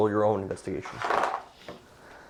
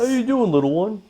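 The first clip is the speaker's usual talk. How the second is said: much higher, louder booming captain's greeting; captain greeting a child; 12 semitones higher, 8 dB louder than usual. Acted. Captain greets the frightened tiefling boy, high and loud. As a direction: boom it out like a jolly captain meeting a small boy.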